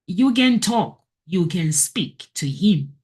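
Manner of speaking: usual speaking voice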